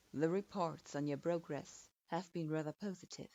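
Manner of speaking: a hint of a measured authority figure; lightly voiced older man. Man's voice.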